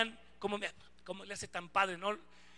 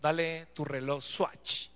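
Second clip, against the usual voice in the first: Dios; 6 semitones lower, slower than usual. Retold or imitated. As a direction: say it slowly and simply in a lowered voice. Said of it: "Me dice Dios". (voz de Dios, pausada y grave)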